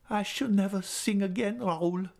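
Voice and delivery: put-on high, gentle young woman's voice; Christine voice. A narrator's reading. Christine telling Raoul goodbye, high and sorrowful.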